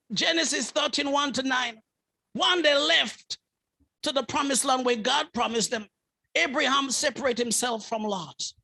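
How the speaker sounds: ordinary delivery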